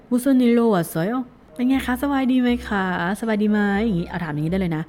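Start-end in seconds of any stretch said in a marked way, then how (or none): 0.1-1.1 quick, run-together phrase; fast read. Shown as a demonstration. Teacher showing the fast version to students. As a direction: say it smoothly at full speed. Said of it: "เวอร์ชันพูดเร็วเนี่ย".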